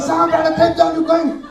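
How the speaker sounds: high, loud hustling taxi caller; taxi guard. Retold or imitated. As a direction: bark it like a taxi guard chasing riders. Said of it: "They were like".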